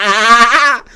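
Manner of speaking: loud, high put-on "ahh" laugh; exaggerated mock guffaw; forced fake laugh. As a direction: laugh along loudly without getting the joke. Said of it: "I have to laugh too".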